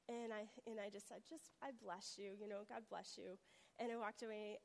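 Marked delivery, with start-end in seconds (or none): 1.3-3.4 soft, lower and humble; hushed, conciliatory murmur; gentle retold blessing. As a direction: bless them quietly, letting it go. Retold gentle blessing to her critic.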